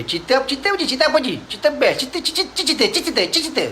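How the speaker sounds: very high, chattering repetition; crowd clamouring. Retold or imitated. The crowd begging Nadr to tell it, high chatter. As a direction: clamour eagerly for the story.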